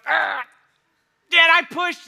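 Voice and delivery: grunting effort under a heavy bar; straining push. Retold or imitated. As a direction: strain out the words under the weight.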